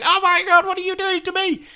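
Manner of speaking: high, mock-horrified squeal; screaming carrot. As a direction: wail in high pitch as a vegetable being chopped. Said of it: "it's screaming at you".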